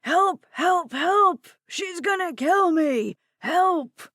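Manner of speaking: high, loud repeated screams for help; panicked cries. Acted. Old man screaming he will be killed. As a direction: yell in terror, over and over. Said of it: "The man's cries continued".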